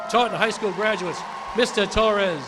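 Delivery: rising to a loud called-out name; announcer calling Mister Torres to the podium; ceremonial announcement. Real, not acted. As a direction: boom the name across the hall. Announcing graduates, calling up speaker.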